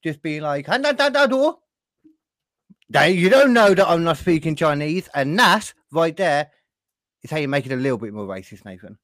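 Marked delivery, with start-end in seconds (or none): 0.7-5.1 high, loud mock Chinese accent. Joking to his co-host about language, in a mock Chinese accent. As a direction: put on a hammed-up Chinese accent, pitched up. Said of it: "go even worse with the voice".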